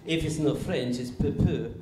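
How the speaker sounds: put-on wine taster with a thick French accent. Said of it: "one of my French friends".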